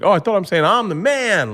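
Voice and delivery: high-pitched comic protest; mock surprised realisation. Comic retells learning his Russian mistake from Igor, mock surprise. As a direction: pitch it way up as a retold discovery.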